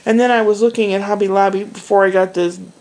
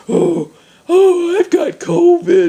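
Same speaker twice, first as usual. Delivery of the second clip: high, whining mock panic; whiny alarm; 9 semitones higher, 4 dB louder, slower than usual. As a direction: wail it like a hammy complaint. Quoting the woman's reaction.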